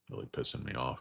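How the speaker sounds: a muttered gripe under his breath; whispered. Real, not acted. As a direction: whisper it, annoyed. Muttered annoyance.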